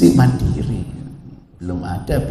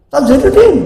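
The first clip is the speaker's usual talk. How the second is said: high, loud call of a name; retold yell; 14 semitones higher, 8 dB louder than usual. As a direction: shout the name across the house. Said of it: "diteriakin saya".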